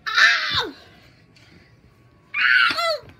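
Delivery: two high loud yells; short high "ah" cries; startled screams. Pretend. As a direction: scream out in mock alarm. Screams after being hit.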